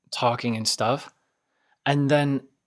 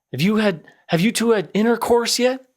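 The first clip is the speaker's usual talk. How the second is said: high and louder, blurting the crude question; loud crude drunk; 10 semitones higher, 4 dB louder than usual. Retold or imitated. Drunk guy asks crude question.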